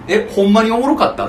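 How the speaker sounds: high, loud, incredulous query; challenging question. Acted. Confronting someone who claimed amusement, loud challenging question. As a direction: press them on it in disbelief. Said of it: "俺ほんまに聞くもん".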